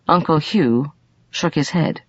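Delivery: ordinary delivery, nothing marked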